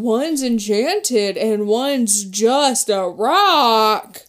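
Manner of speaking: loud, slow mock mystery; mock mysterious voice. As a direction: ham up the riddle slowly. Mocking voice of book.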